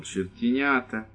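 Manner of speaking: slow, soft fond mutter; tender mutter. Acted. Priest muttering fondly at the kids, slow and soft. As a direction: murmur it fondly under the breath. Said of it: "ласково бормотал".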